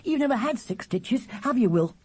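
put-on very high, eager child's voice; piercing little brother. A narrator's reading. Charlie plying his brother with questions, piercing. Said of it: "as loud and piercing as ever".

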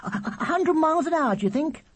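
put-on very high, thrilled child's voice; excited squeak. A narrator's reading. Charlie asking Will about his speed, excited squeak. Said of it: "squeaked Charlie excitedly".

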